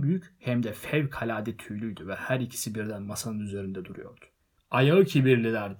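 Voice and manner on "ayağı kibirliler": loud repeat, close to the usual pitch; firm repeat. A narrator's reading. Bilbo repeating the name after the heckler, loud but controlled. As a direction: say it back firmly to the crowd. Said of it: "diye tekrarladı Bilbo".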